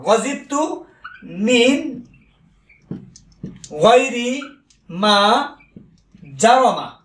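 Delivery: গজিবতু মিন গায়রি মা জারমা said very slowly, with long pauses between the words; ধীরে ধীরে, প্রতিটি শব্দ আলাদা করে বলা; slow dictation. Shown as a demonstration. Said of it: "খেয়াল করেন".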